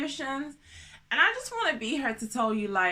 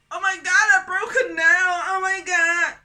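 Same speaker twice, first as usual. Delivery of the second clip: loud, high mock panic over a broken nail; mock dramatic woman; 8 semitones higher, 8 dB louder than usual. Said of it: "we're like".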